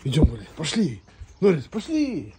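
loud, rising calls; calling a dog. Real, not acted. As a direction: urge the dog out loudly.

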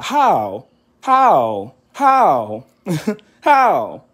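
building from firm to laughing challenge; repeated demanding question. Real, not acted. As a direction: hammer the question home.

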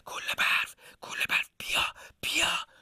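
urgent stage whisper; forceful hushed call, all breath; stealthy whisper. Acted. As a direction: whisper to call someone secretly. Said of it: "یواشکی رفت کنارش و آروم گفت".

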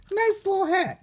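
high, amused delight at a tiny hat. Real, not acted. Unboxer admiring a toy's little hat, delighted. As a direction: gush over how cute it is.

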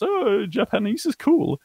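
high, slow, breezy fan voice; airy casual fan. Pretend. Casual learner voiced lightly.

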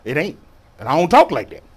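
ordinary talk, nothing marked

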